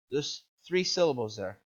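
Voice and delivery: ordinary delivery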